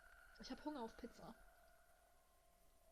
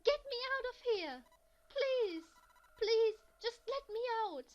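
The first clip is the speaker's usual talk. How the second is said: much higher, much louder frantic begging; mindless cry; 11 semitones higher, 15 dB louder than usual. Acted. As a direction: scream mindlessly at the door. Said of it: "a desperate, mindless cry".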